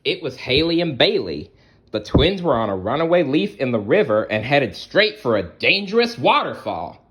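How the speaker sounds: bright, lively read-aloud voice; storybook narration. A narrator's reading. Storyteller reading aloud to children. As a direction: tell it like a bedtime picture book.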